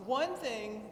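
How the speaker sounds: ordinary talk, nothing marked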